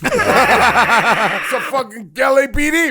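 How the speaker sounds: laughing through it, loud and slow; loud laughing disbelief. Real, not acted. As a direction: crack up while telling. Laughing at the punchline.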